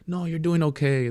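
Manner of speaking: high, placating mock reassurance; imitated evasive reassurance. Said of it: "There was no bluntness".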